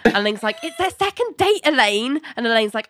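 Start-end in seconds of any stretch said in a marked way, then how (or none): 0.6-2.2 high-pitched, exasperated protest; retold irritation, pitched right up; mock indignant retort. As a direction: snipe back in a high acted voice. Acted irritated retort as Ling.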